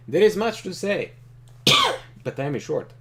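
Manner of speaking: a lightly voiced calm, powerful leader; Trakov voice. Reading Trakov's lines to the player, calm and commanding. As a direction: play him as a composed ruler opening talks.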